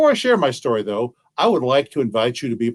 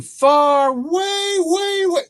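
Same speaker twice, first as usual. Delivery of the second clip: much higher, slow, stretching far way way way; a storyteller's high drawn-out far, way, way, way; high stretched far way; much slower than usual. A narrator's reading. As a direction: pull each way up high and long. Exaggerated distance, pitch climbs.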